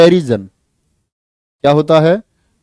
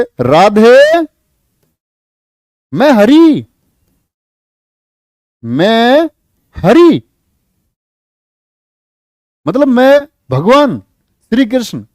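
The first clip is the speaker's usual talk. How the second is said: higher, louder proud reply as Krishna; Krishna answering; 6 semitones higher, 4 dB louder, slower than usual. Acted. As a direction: announce yourself as Krishna. Teacher voices Krishna answering.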